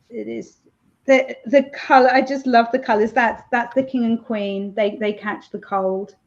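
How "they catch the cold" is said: ordinary delivery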